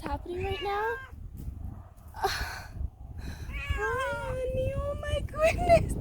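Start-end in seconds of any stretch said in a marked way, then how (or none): 2.2-5.9 cooing to a kitten. Real, not acted. Cooing at the cat.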